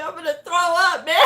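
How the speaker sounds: high, loud, laughing squeal; hammed-up giddy outburst; overwhelmed excitement. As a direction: cry it out, giddy and overcome. Retold overwhelmed joy at passing.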